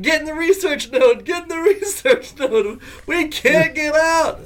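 high frantic laughing orders; urging everyone to hide. Retold or imitated. Friend yelling to hide from the spider, high laughing panic. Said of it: "And Child's like".

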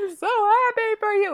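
high teary mom voice; mom crying happily. Retold or imitated. Mother hearing the engagement news, high teary voice. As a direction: imitate a tearful mom lightly. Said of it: "because she's like".

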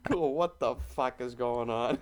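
ordinary talk, nothing marked

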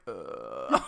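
low disgusted groan; a deep mock ugh; Loki groan. Loki left alone after Sif leaves, groaning.

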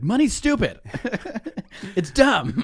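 loud, high comic rant; mock outburst. Poor person ranting about money, mock outburst. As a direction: blurt it out indignantly, half laughing. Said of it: "I'm like".

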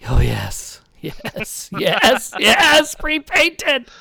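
giddy, building yes, yes, yes; excited. Real, not acted. Eager yes about pre-painted minis.

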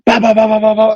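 a soldier hamming up his rifle fire; mock gunfire bursts. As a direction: spit out rapid mouth gunfire like a kid playing war. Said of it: "he gets up over the wall and he's like".

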